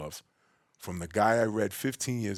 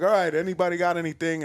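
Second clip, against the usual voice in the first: raised, higher host's voice to the room; louder retold shout-out to a group; calling out; 7 semitones higher, 6 dB louder than usual. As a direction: call it out to a whole room. Voicing the seminar host calling out.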